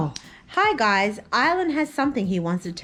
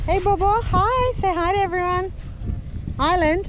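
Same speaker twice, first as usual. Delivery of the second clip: put-on baby talk; 10 semitones higher than usual. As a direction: chirp hello to the little one. Greeting the baby.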